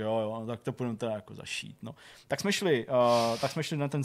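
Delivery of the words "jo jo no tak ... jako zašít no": low, soft, mildly bored quote; imitating bored doctor. Doctor agreeing to stitch up the patient, mildly bored. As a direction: mimic a doctor who has seen it all. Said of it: "tak jako znuděně prostě řekl".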